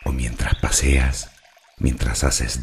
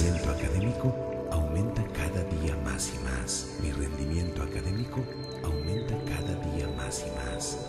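much softer echo, receding into silence; fading echo; 3 semitones higher, 11 dB quieter, faster than usual. Acted. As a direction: repeat the affirmation like a distant, fading inner voice. Affirmation repeated as fading echo. (eco suave que se desvanece)

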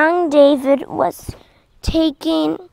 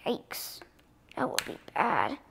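whisper; 5 semitones lower, 14 dB quieter than usual. Real, not acted. Balloon about to go on.

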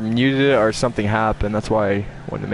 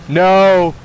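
loud, high shout; 6 semitones higher, 7 dB louder than usual. Real, not acted. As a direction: yell it out. Reacting to game moment.

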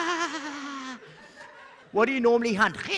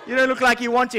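normal talking voice

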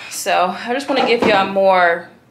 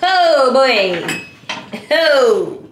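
put-on high, loud sing-song "oh, boy"; higher and louder playful imitation of the kid; imitating Lyric; 5 semitones higher, 7 dB louder, much slower than usual. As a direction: mimic a smug little kid. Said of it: "she just smiled at me like".

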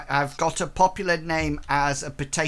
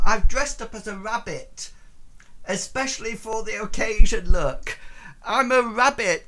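playful excited; 6 semitones higher, 5 dB louder than usual. Real, not acted. High playful costume bragging.

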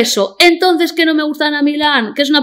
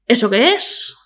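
ordinary delivery

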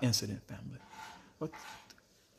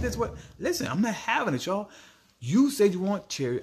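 worked-up rant; 8 semitones higher than usual. Real, not acted. Heated rant to viewers.

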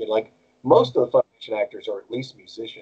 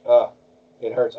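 usual speaking voice

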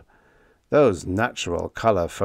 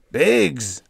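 howled; 6 semitones higher, 4 dB louder than usual. A narrator's reading. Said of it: "howled Cap Hansen".

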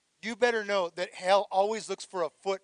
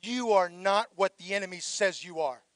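usual speaking voice

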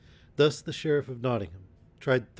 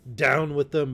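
loud, raised roar of command; angry bellow; 4 semitones higher, 7 dB louder than usual. A narrator's reading. Sheriff setting men on the pair, angry bellow. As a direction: bellow like an angry bull, short of screaming. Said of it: "bellowed the sheriff, in a voice like an angry bull".

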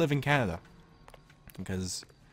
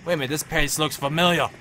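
loud exasperated; 5 semitones higher, 7 dB louder than usual. Real, not acted. Yelling at game partner.

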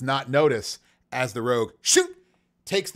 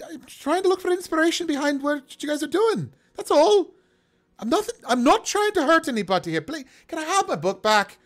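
very high, stammering put-on voice; flustered half-orc; 3 dB louder than usual. Half-orc storyteller defending himself to the party, high and flustered. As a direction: plead your innocence in a high character voice.

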